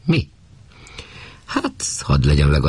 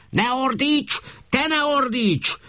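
much higher, snapping back; shrill counter-shout; slower than usual. Acted. Wife snaps at her husband to stop shouting. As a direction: throw the accusation right back at him.

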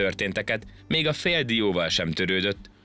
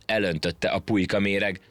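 plain reading voice

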